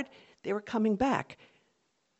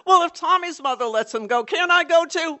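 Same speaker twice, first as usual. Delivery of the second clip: whining protest; 10 semitones higher, 8 dB louder than usual. Retold or imitated. Brother protesting grounding.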